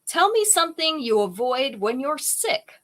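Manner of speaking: ordinary talk, nothing marked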